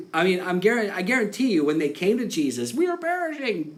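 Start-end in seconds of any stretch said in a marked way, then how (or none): 2.7-3.8 mock panic. Disciples crying to Jesus.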